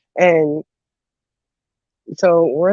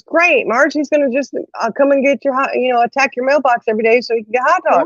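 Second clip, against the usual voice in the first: mock exasperation, higher pitched; exasperated mom; 7 semitones higher than usual. Mom answering neighbor who fed the dog hot dogs, exasperated. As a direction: imitate mom's sarcastic reply. Mom's exasperated reply in retold story.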